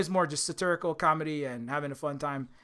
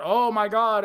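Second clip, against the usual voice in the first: louder, higher mock gasp of dismay; mock outcry; 4 semitones higher, 5 dB louder, slower than usual. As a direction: act out an overblown "big deal" reaction. Mock outcry about what show isn't.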